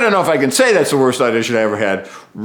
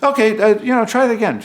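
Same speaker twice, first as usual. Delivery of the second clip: casting director voice; 11 semitones higher, 4 dB louder, faster than usual. Retold or imitated. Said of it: "And he goes".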